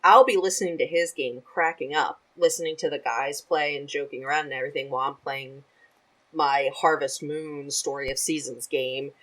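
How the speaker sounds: ordinary talk, nothing marked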